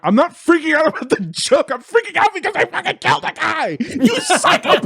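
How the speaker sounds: high, rapid hysterical rant; freaking out. Retold or imitated. Comic answering a calm comedian backstage, freaking out. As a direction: shriek it in mock hysteria, breaking into laughter. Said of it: "I'm freaking the fuck out".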